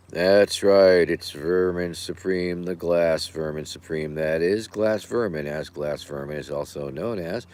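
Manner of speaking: ordinary talk, nothing marked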